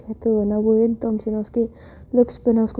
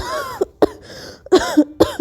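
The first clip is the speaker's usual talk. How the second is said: loud choking cough; harsh coughing fit; 3 dB louder than usual. A narrator's reading. Priyasha after the kiss, coughing hard. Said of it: "एकदम खांसते हुए कहती है".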